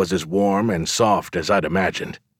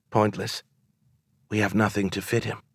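softer, hushed woman's voice; whispered; 5 dB quieter than usual. A narrator's reading. Woman answering about fitting the giant, whispered. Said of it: "she whispered, always whispered".

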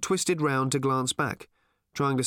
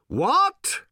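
loud, high, rising shout; 6 dB louder than usual. A narrator's reading. Old man deafened by hooves, shouted query. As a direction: yell it as if unable to hear. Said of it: "shouted old Thrashbarg".

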